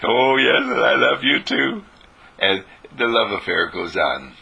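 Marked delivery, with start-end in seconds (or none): 0.0-1.8 a voiced sky, higher and a bit louder; the sky answering. The sky replying to its creator, loving answer. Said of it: "the sky looks back at you and says".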